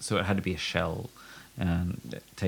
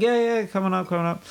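cheerful builders; 11 semitones higher, faster than usual. Retold or imitated. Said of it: "They're like".

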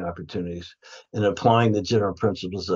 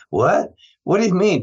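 retold startled what, jumping higher; sudden surprised question, pitched up; 7 semitones higher than usual. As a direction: mimic a patient jolted out of her gloom. Patient's head snaps up.

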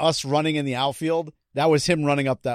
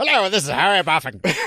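crank call voice; 3 semitones higher, 4 dB louder than usual. Pretend.

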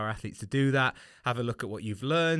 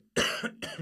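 coughing. Real, not acted. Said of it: "just coughing".

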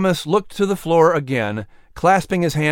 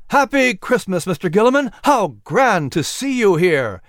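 high, raised festive greeting; delighted exclamation; 7 semitones higher, 4 dB louder than usual. Acted. Patrick greets Gilliman over the delighted crowd, exclaiming high. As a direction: call out happily over a noisy crowd. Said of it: "exclaimed over the sound of the delighted crowd".

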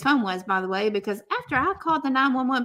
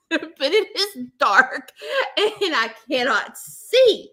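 laughing through it; 4 semitones higher, 5 dB louder, slower than usual. Real, not acted. Laughing while retelling panic.

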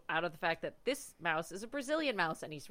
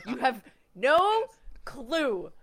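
slow and louder, every word pressed; drawn-out emphasis; 4 semitones higher, 5 dB louder, much slower than usual. Real, not acted. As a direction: drag it out to insist. Stressing how surprising movie is.

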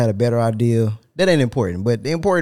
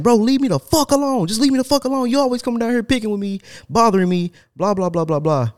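higher, pushed exasperated yell; heated retold outburst; 11 semitones higher than usual. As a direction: tell him off, fed up.

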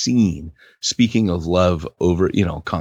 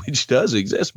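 normal talking voice

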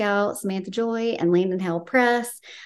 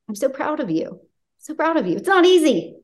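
getting emotional; 6 semitones higher than usual. Real, not acted. Host tells her author she's proud, getting emotional. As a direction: voice rising high with real emotion. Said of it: "And now I'm getting emotional".

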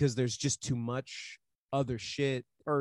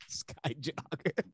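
laughing; 6 dB quieter, slower than usual. Real, not acted. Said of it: "laughing repeat".

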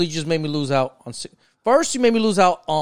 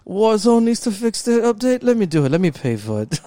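rich voice; 5 semitones higher than usual. Pretend. Joking about lottery riches, higher smug rich voice. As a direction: play a rich guy flaunting money. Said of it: "That's awful".

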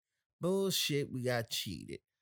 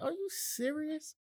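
much higher, slow are you serious; incredulous question; slower than usual. Retold or imitated. Host retells answering the angry listener, incredulous. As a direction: let the disbelief stretch out. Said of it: "I said".

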